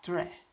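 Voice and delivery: tre said with tongue tap then R. Shown as a demonstration. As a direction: blend T into R: tre. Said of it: "sería". (tre, la T unida a la R)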